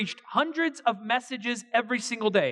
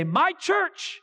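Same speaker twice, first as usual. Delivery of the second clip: put-on excited child; 10 semitones higher than usual. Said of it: "and he will say".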